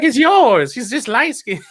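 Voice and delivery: loud comic bit; comedian impression. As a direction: ham up the comedian's punchline. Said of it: "he's like".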